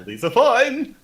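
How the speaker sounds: high, loud bluff with a rising end; unconvincing reassurance. Acted. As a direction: oversell it, pitch shooting up. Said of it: "see how convincing I am with the whole".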